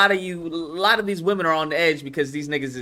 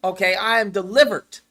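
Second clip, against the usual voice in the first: loud, raised mock proclamation; mock testimony; 5 semitones higher, 7 dB louder than usual. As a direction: imitate someone proclaiming deliverance. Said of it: "one of those who's like".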